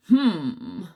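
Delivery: loud musing hum; a curious stag's hum. Acted. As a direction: stag hums at the clearing. Stag voice in story.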